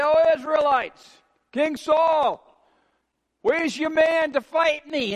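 giant's taunting challenge. Acted. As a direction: call out a challenge across to an army. Goliath calls out his challenge.

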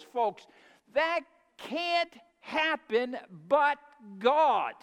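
a rising preacher's climax, words drawn out; preaching at full force. Acted. Preaching climax against evolution.

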